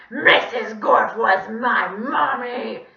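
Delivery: loud, drawn-out villain shriek; scary squawk. A narrator's reading. Villain revealing his mother to frightened children, scary squawk. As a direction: squawk it slowly at full voice. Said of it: "even scarier than his real voice".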